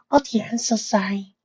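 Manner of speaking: flustered little pony; high timid voice. Acted. Fluttershy embarrassed.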